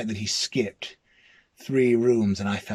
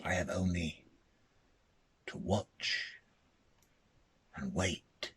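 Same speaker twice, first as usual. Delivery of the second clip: ominous hush; 4 semitones lower, 4 dB quieter than usual. A narrator's reading. Dread ending of ghost story.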